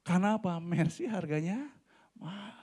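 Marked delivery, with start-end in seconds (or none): 2.1-2.5 said softly. Shown as a demonstration. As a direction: drop the voice right down on mahal before the whisper. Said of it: "Jangan keras-keras ngomongnya". (mahal diucapkan pelan)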